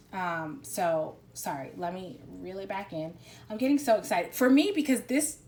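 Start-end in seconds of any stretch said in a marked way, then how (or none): 3.5-5.4 higher, louder excited outburst; real excitement. Real, not acted. Reader telling the client how much this moves her, excited. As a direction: get carried away with real excitement.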